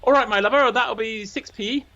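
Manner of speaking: high, sing-song Cornish voice, slowing on the fare; cheerful conductor calling passengers "my lover"; Cornish bus conductor. Retold or imitated. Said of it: "a Cornish bus conductor".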